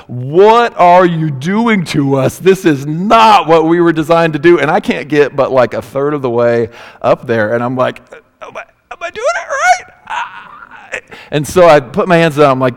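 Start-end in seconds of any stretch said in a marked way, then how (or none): 0.0-4.6 high, loud, slow protesting voice of the muscles; screaming muscles. Retold or imitated. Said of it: "are just screaming at me, like". 8.4-10.4 very high strained voice with a laugh; strained squeak. Acted. Straining against the wall exercise.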